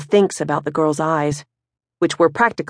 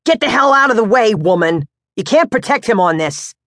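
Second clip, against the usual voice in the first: higher, louder, quick growl at his wife; angry father; 5 semitones higher, 3 dB louder than usual. Acted. Pops to his wife as she blocks him, angry. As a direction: push her aside with words.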